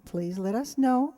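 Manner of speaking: ordinary delivery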